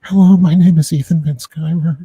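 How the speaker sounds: Ethan Van Sciver. Retold or imitated. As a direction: imitate a whispery, nervous first-time host. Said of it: "I was all whispers, just like".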